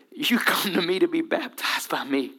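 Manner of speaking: a secret, breathy hush; hushed whisper. Acted. John whispering to Jesus in the river, hushed. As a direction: whisper it low in awe.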